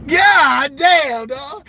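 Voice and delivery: a loud shocked "God damn"; shocked exclamation. Real, not acted. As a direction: blurt it out in real disbelief. Shock at car hit.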